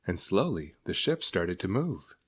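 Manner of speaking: usual speaking voice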